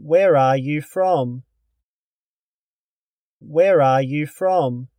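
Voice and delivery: ordinary talk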